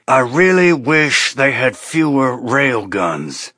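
slow, strained through clenched teeth. A narrator's reading. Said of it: "from between clenched teeth".

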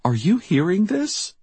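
high, upset question; voice cracking. A narrator's reading. Tearful Diane asking Jackie about the news, voice cracking. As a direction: ask it distressed, pitch lifted. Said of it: "Diane's voice cracked".

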